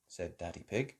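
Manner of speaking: plain reading voice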